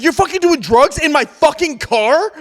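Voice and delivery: slow, disbelieving retold outrage; mad at me. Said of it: "Like, mad at me".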